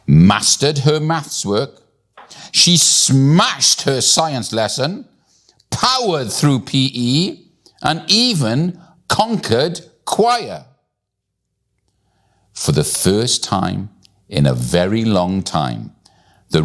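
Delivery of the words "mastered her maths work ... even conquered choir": high, emphatic run of victories; triumphant. A narrator's reading. Narrator listing Millie's wins at school, triumphant. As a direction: punch each feat higher, stretching into the finish.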